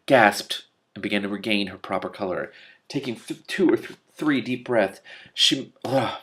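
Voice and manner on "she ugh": usual speaking voice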